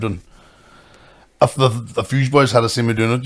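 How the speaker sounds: ordinary delivery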